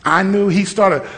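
ordinary talk

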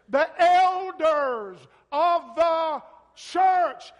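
thundering sermon delivery stretched out; full-force preaching. Acted. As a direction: proclaim the verse to the rafters, slowly.

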